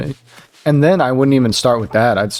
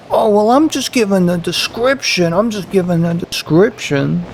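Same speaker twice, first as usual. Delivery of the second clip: higher, whiny excuse in a put-on voice; defensive coworker; 7 semitones higher than usual. Coworker defending himself, mocked in a higher voice. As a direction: play innocent, just giving facts.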